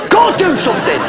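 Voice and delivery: a mock Barnsley voice ordering him out of bed. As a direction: bark the order the way a Barnsley voice would. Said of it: "A voice that meant business".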